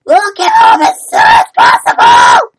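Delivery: high, hammed-up yell; panicked shout. Acted reassurance amid panic.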